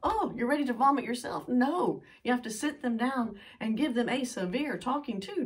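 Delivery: normal talking voice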